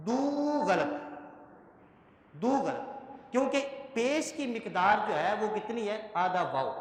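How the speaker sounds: ordinary talk, nothing marked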